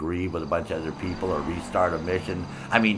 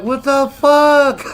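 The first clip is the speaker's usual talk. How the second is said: loud, slow, high disbelieving yell; stretched-out mock yell of disbelief; retold outraged shout; 11 dB louder, much slower than usual. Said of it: "I'm like".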